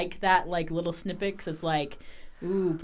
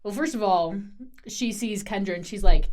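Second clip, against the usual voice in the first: ordinary talk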